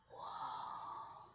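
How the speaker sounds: hushed, unvoiced whoa; soft whispered amazement; awed. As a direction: whisper it in awe. Character sees the big cage.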